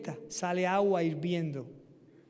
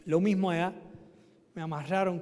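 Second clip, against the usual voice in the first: normal talking voice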